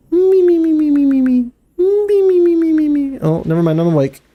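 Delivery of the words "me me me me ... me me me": high mimimi snore whistle; cartoon sleeper whistling out breath; snoring whistle. Snore whistle after honk shoo.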